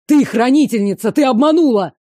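loud furious accusation; angry shout. Acted. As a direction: yell it in her face. Vampire prince accuses her, enraged.

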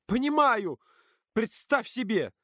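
high, indignant cry; heated, high-voiced comeback; defiant outburst. A narrator's reading. Defiant shout back in quarrel.

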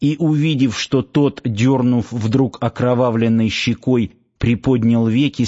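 ordinary delivery

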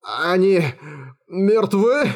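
halting, horrified question; frightened captive, broken by a pause; trembling moan. A narrator's reading. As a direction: moan it in a shaky voice, rising. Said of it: "он простонал дрожащим голосом".

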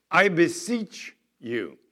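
slow, loud, drawn-out beseeching; loud plea. Shown as a demonstration. Preacher reading Paul's plea to the church, slow and loud. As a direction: lean on it like a shouted text in capitals. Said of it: "Notice the word beseech there is all capital".

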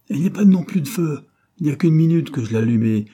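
loud, high, irritable nagging; cross scolding. A narrator's reading. Wife scolding husband about the fire, loud and cross. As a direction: scold him loudly, pitch up. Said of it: "gronda sa femme avec sa mauvaise humeur habituelle".

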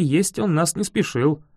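plain reading voice

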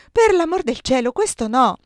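high, loud outcry; yelled protest. A narrator's reading. Narrator voicing the bride's outcry to her fiancé, yelled. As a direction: cry out in protest, high and forceful. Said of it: "Io urlai addirittura".

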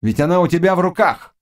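high-pitched exasperated witch; angry exclamation. A narrator's reading. Witch berating the hesitant Cossack, high and angry. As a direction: urge him on, high and vexed. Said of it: "сердито вскрикнула Гарпина".

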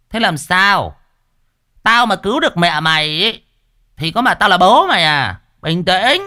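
put-on high, loud grumbling old man; grumpy master scolding. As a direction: tell the noisy girl off with mock irritation. Master Cẩn scolding girl.

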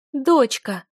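high, loud cry of alarm; piercing maternal shout; mother's scream. A narrator's reading. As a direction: call out in panic. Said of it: "закричала мама".

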